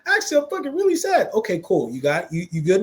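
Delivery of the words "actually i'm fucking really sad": admitting sadness. Retold or imitated. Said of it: "Is there something under that?".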